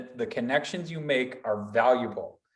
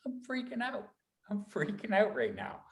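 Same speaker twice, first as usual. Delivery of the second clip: hushed, high panicked voice; quietly panicking inside; freaking out; 7 semitones higher, 8 dB quieter than usual. Retold or imitated. Nervous person confessing nerves, hushed and high with panic. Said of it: "and I'm like".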